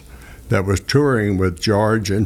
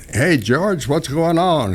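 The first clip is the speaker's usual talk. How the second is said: cheerful greeting; 7 semitones higher, slower than usual. Retold or imitated. Calling out greeting to George.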